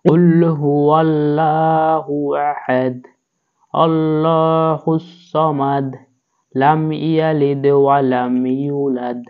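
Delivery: slow chanted recitation of the whole surah; melodic Quranic recitation. Shown as a demonstration. Teacher reciting Surah Ikhlas from the start. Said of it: "প্রথম থেকে আবার পড়ি".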